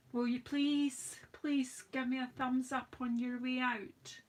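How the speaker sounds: ordinary delivery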